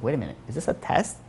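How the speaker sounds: a secret dawning, whispered to himself; hushed realization. Retold or imitated. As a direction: drop to a soft, breathless whisper. Suspect realizes it is a test.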